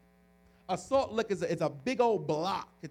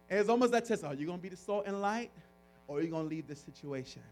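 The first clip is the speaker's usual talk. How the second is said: ordinary talk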